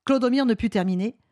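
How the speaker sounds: ordinary delivery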